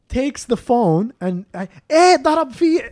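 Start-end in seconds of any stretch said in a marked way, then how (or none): 1.9-2.8 put-on high, loud Lebanese-accented complaint. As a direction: imitate an angry man in a Lebanese accent. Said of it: "I'm giving him a Lebanese accent".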